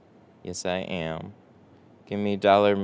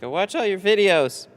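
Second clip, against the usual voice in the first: pitched-up, louder imitation of the fan; lightly mock the caller in a higher voice; 10 semitones higher, 5 dB louder than usual. Streamer mimics a caller who watched his videos, high mock voice. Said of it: "He was like".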